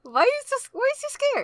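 high, sing-song mock questioning; mock taunt. Riffing on being scared of just a guy, high playful taunt. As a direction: pitch it way up like a teasing chant.

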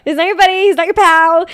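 high, loud indignant chant; mock outrage. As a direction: protest high and loud, playfully scandalized. Mock outrage over the word buddy.

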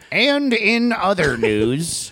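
high, slow anchor voice; news anchor voice. Pretend. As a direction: stretch it out like an anchor. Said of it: "talked like a news anchor".

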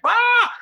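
high squeal of delight; excited shriek. Retold or imitated. Squealing to his colleague over the Paris gig, sudden high shriek. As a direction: let out a thrilled high yelp. Thrilled scream at the offer.

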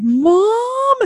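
high whiny call of Mom; mock kid whine; kids whining. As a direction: drag out Mom like an annoyed kid. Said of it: "My kids are just like".

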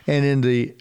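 ordinary talk, nothing marked

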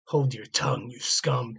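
normal talking voice

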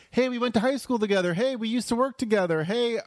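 high, eager reintroduction; mock eager reminder. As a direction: jog someone's memory with acted pep. Acting out prompting an old acquaintance.

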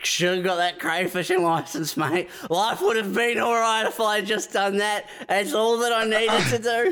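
hammed-up high regretful whine; mock regretful bloke. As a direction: wail it like a man who missed out. Imitating the one who regrets.

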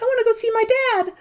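put-on high little girl plea; high childish voice. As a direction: whine it like a small child. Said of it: "Closet's like".

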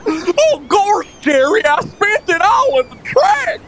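high, wacky sitcom voice; Seinfeld character voice. Retold or imitated. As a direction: yelp it like a sitcom neighbor. Seinfeld impression riff.

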